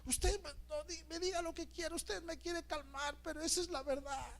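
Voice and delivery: imitated grieving sister crying out to the pastor; wailing sister. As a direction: wail out her grief for her dead brother. Pegando de gritos.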